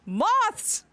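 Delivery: frustrated Moths, high and loud; insistent moths. Shown as a demonstration. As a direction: Moths pitched high, exasperated. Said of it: "damn this accent".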